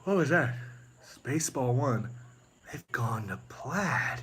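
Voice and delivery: slow, put-on movie voice; Spaceballs quote. Officer reports the ship's escape, slow deadpan voice. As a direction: draw out the line like a deadpan officer.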